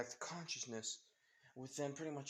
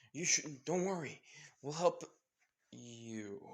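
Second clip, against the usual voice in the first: heroic reassurance; 3 semitones higher, 4 dB louder, slower than usual. Acted. Heroes reassure in retold story.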